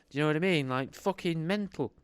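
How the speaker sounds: ordinary delivery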